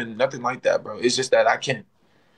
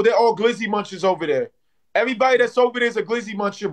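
ordinary delivery

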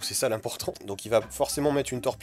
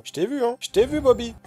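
higher, louder sing-song tease; teasing; 10 semitones higher, 6 dB louder than usual. Real, not acted. Streamer teasing the opponent he caught, higher and louder. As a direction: catch him out with a playful jab.

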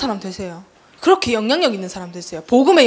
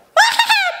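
high-pitched jeering laugh; mocking laugh. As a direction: imitate someone laughing in scorn. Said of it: "비웃는 거야". (비웃는 높은 웃음소리)

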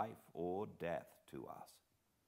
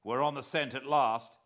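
louder, higher eager shout; excited cry; 7 semitones higher, 11 dB louder than usual. A narrator's reading. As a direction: burst out with the thrill of the chase. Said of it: "I cried excitedly".

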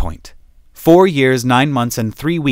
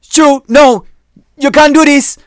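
high, loud, desperate yell; panicked shout; 11 dB louder than usual. Acted. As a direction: scream it as the door opens. Zane panics in airlock.

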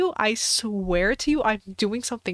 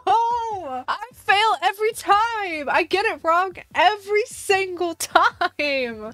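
high, loud laughing cry of defeat; laugh-wail through the disappointment; frustrated laughing wail; 8 semitones higher, 3 dB louder, slower than usual. Real, not acted. Wrong guess again, frustrated.

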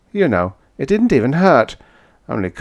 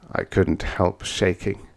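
usual speaking voice

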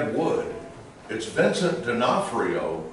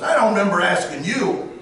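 menacing line; 7 semitones higher, 4 dB louder than usual. Retold or imitated. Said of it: "He turns around and says".

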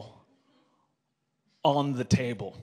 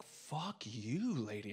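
a hushed "fuck you, lady" aimed at her; muttered curse; 2 semitones lower, 13 dB quieter, much slower than usual. Retold or imitated. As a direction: curse under the breath, much softer. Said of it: "I'm just going like".

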